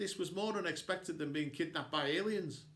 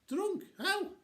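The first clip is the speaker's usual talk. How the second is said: high-pitched disbelieving questions; astonished. A narrator's reading. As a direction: squeak it out in shock. Accused of getting drunk, astonished.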